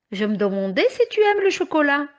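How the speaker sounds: usual speaking voice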